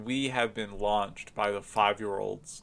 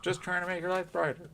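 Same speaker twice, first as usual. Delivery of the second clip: higher, faster put-on announcer voice; old radio announcer; 7 semitones higher, faster than usual. Shown as a demonstration. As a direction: repeat it in a hammed-up announcer voice. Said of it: "repeated in a put-on voice".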